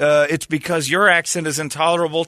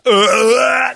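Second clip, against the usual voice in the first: loud outraged groan; 11 semitones higher, 6 dB louder than usual. Real, not acted. Outraged reaction to make out.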